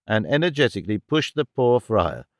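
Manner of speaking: ordinary delivery, nothing marked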